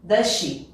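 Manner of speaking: DOES SHE as "duh-shee"; DOES SHE linked. Shown as a demonstration. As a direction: merge DOES SHE into a single SH. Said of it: "aqui vai virar".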